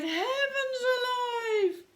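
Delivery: put-on high, wailing old lady voice; distressed old lady. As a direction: cry it out like a frantic old lady. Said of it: "The little old lady cried".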